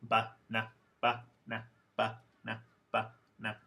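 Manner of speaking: "ba-na" with both vowels a neutral uh; banana without middle syllable. Shown as a demonstration. Teacher demonstrating banana minus its stressed syllable, schwa-only "ba-na". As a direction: say "Ba-na" as two short unstressed schwa syllables. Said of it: "what it would sound like without the middle syllable".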